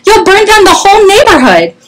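very high, alarmed mother's cry; high-pitched storybook alarm. A narrator's reading. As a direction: wail the warning like a panicking storybook mother. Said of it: "cried his mother".

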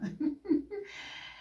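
squealing laugh; high laugh. Real, not acted. Vlogger giggling at the husband's demand, high.

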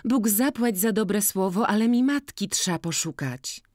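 put-on high, loud, bright-eyed girl complaining of the seller; lively village girl. Jagna asking Boryna to judge the cap, high and loud. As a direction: pitch it up and call out to Maciej.

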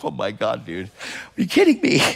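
exasperated dude, are you kidding; mock stunned outrage; incredulous. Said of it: "I'm like".

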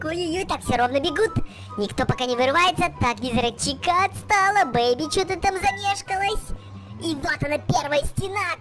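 fast, high play-by-play; excited race commentary. Acted. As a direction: call the race live.

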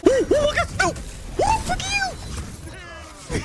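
very high, frantic shrieks; shrieking jackpot winner. Real, not acted. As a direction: shriek like you just hit the jackpot. Frantic in-game moment.

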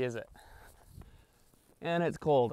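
ordinary talk, nothing marked

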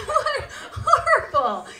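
high, slow laugh-through word. Real, not acted. Comic recoiling from the prayer, laughing.

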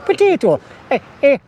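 high-pitched mock Irish accent. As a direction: squeak it in a joke Irish accent. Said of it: "enough of the Irish accent".

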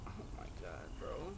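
breathless whispered disbelief; shocked whisper. Real, not acted. Gamer whispering in shock after the friend dies. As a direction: barely breathe the words out. Reacts to Jeff's death.

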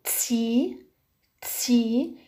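"تسي" as t-s into long i; ti as tsi. Shown as a demonstration. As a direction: sound out "تسي" as "tsee". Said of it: "تي مع حرف إي تنطق". ("تسي" تاء وسين ثم ياء)

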